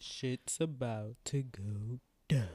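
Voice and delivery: low, slow, drawn-out foreshadowing; mock ominous. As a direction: stretch every word like a hammed-up cliffhanger warning.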